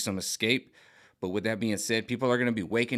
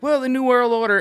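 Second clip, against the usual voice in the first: much higher, louder mocking squeak of the official; 10 dB louder than usual. Mimicking the health director on the show, a squeaky high parody. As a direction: parody her in a shrill cartoon pitch. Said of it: "showed a thing where she was like".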